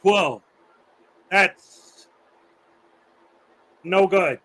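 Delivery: mild imitation of a stern math teacher quizzing the class; teacher impression. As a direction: bark out the questions like a coach calling plays. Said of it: "He had this voice like this".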